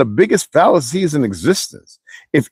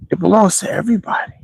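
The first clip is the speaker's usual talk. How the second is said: whisper; 6 semitones higher, faster than usual. Real, not acted. Rhetorical aside, dropped to whisper.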